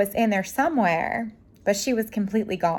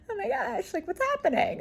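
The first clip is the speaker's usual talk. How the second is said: much higher and softer, a hammed-up weepy whimper; mock teary panic; 12 semitones higher, 6 dB quieter than usual. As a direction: play at being suddenly overwhelmed by an ad. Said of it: "and I'm like".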